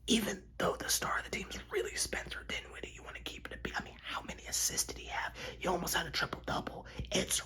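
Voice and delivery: hushed, voiceless confidential aside; whisper. Pretend. Host confiding who the team's real star is, whispered. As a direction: whisper it like a secret between fans.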